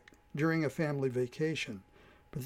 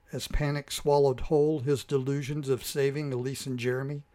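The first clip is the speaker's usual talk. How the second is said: ordinary delivery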